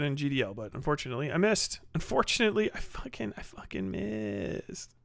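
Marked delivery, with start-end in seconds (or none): 3.4-4.9 slow, breathless whispered curse; muttered to himself after a miss; frustrated whisper. Real, not acted. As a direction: hiss it under your breath, annoyed. Frustrated after missing the drop.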